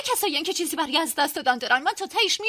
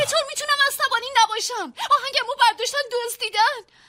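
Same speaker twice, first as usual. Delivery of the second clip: higher, louder indignant anger; angry outburst; 6 semitones higher, 3 dB louder than usual. Acted. Musician telling his friend the song was stolen, angry.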